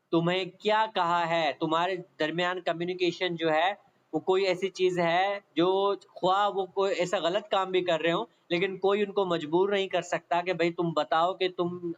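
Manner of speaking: ordinary talk, nothing marked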